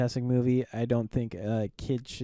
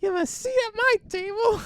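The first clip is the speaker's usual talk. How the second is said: put-on squeaky high child voice; nine-year-old action hero; 3 dB louder than usual. Co-host as the child star inviting family, squeaky kid voice. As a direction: play a tiny tough guy, very high. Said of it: "Can you imagine?".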